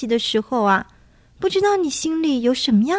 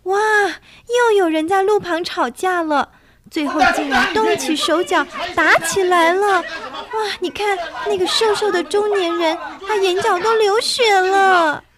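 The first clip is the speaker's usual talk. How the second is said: high, breathless alarmed storytelling; scared excitement; 6 semitones higher than usual. Acted. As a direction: gasp at the horrors for kids. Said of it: "好恐怖啊".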